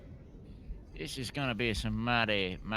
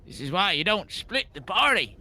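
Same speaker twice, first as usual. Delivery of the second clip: mock scolding; 6 semitones higher than usual. Player scolds group as fight starts.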